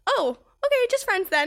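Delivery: high, loud embarrassed backpedal; flustered lady. Retold or imitated. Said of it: "She was like".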